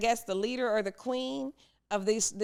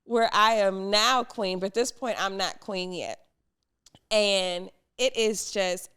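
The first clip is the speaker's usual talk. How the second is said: ordinary talk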